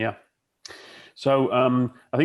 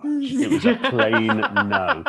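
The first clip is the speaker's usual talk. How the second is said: high-pitched voice breaking into laughter; laughing mock voice; 5 dB louder, much slower than usual. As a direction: squeak it out while laughing. Continues joke voice, laughing.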